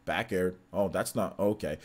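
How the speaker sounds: low, subdued streamer commentary; boring normal voice. Retold or imitated. Imitating a dull streamer mid-game, boring normal voice. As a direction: play it lifeless and under-energized. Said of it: "They talk in their normal voice".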